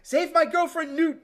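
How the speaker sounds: high, loud cheering at the screen; excited kid yelling. Retold or imitated. Kid rooting at the movie screen, high excited yell. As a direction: cheer the hero on like a kid in the theater. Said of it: "And I'm like".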